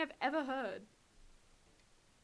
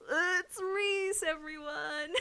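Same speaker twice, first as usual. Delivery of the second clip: laughing reveal; 7 semitones higher, much slower than usual. Real, not acted. Reveals cat is Reese.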